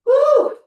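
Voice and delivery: loud, high "woo"; real workout holler; excited whoop. Real, not acted. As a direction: let out a big high whoop. Psyching up before timed set.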